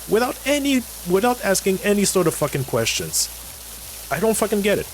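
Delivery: high-pitched, incredulous rant; exasperated disbelief. Real, not acted. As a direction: pitch jumps up in real exasperation. Ranting in disbelief at brainwashed people.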